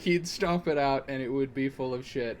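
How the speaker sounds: ordinary talk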